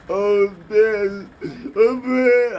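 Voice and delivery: high, loud fake wailing; mock crying. Mocking whiners' posts with a loud fake sob. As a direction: ham up a blubbering crybaby. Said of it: "That's why I'm not crying".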